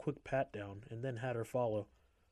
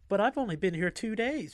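cutesy; 9 semitones higher, 7 dB louder than usual. A narrator's reading. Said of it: "followed by a cutesy little giggle".

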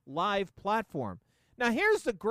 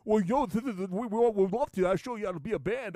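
whiny stuttering rock drummer. Retold or imitated. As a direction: stammer it out, lightly whiny. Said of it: "[name]'s whiny voice".

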